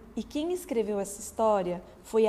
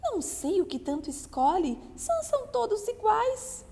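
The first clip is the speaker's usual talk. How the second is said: tiny tinkling fairy voice; 11 semitones higher than usual. Acted. Fairy replies.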